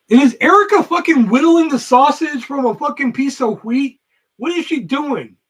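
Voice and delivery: loud, high-pitched incredulous complaint; exasperated outburst. Real, not acted. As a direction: burst out in exasperated disbelief. Exasperated aside about Erica's absence.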